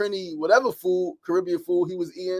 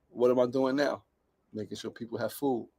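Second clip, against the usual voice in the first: low, soft question and answer; quiet reflection; 7 semitones lower, 7 dB quieter than usual. Real, not acted. Man reflecting on following his grandfather, quiet and low. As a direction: say it low, almost to yourself.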